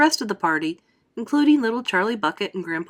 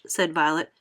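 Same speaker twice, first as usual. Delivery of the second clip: usual speaking voice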